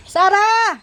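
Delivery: loud, high call across the yard; shouting a dog's name to come eat. Real, not acted. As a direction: call the hiding dog from a distance. Calling shy dog from afar.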